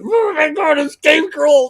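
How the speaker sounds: high, loud inner squeal of disbelief; starstruck inner shout. Retold or imitated. Radio host recalls meeting [name], mock starstruck shout. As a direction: freak out silently, like a giddy fan. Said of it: "in the back of my head, I'm going".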